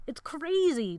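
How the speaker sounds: high-pitched alarmed yell. A narrator's reading. Gerald protesting Jonathan's plan, high yell. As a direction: cry out in protest. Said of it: "Gerald yelled".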